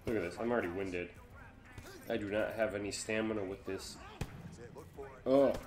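ordinary talk, nothing marked

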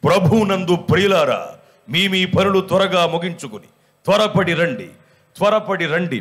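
booming church announcer; mike announcement. Retold or imitated. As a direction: announce it like calling the village to church over a mike. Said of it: "mic పెట్టి".

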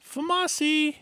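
high, loud playful squeal; mock-excited high holler; silly cry. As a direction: call out the nickname in a silly high voice. Silly cry of a weapon nickname.

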